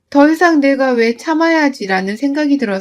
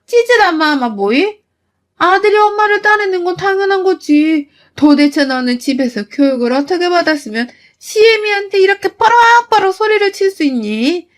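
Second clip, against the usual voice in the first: a mother-in-law shrieking in offended fury; outraged mother-in-law; 5 semitones higher than usual. Acted. 시어머니가 격분해 따짐. (훨씬 높고 큰 목소리로 격분한 시어머니)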